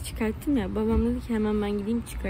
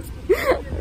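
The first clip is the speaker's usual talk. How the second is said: nervous laugh; 11 semitones higher, 4 dB louder than usual. Real, not acted. Laughing close to tears.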